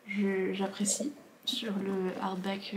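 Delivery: soft, slow whispered aside. Real, not acted. Booktuber whispers to herself while admiring the hardback. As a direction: murmur it to yourself while turning the book.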